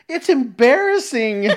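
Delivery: put-on high, drawn-out kid voice; laughing imitation of an embarrassed kid; little kid. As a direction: whine it slowly like a mortified child. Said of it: "I hear the kid say something like".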